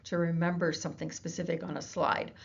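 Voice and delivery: normal talking voice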